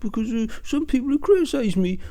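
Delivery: high, put-on simpering worry; sneering high-pitched mock of a worrier; mocking whiny voice. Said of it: "within the first two minutes you go".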